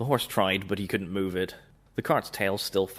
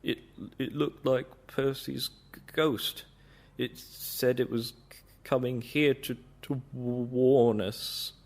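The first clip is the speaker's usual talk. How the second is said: shaky voice; 3 semitones higher, slower than usual. Acted. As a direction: stutter out the words in fear. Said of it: "said Toby in a shaky voice".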